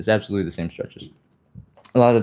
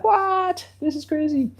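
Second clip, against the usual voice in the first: squeaky, much higher cartoon voice; protesting hamstrings. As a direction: play a shocked little muscle crying out. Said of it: "Your hamstrings are gonna cry out a little bit and be like".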